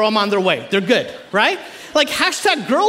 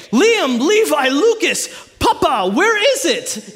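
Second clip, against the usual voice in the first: raised mock-urgent summons; calling out; 3 semitones higher, slower than usual. Parents calling sons to find the pacifier, mock-urgent yell. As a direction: round up the kids with a mock yell.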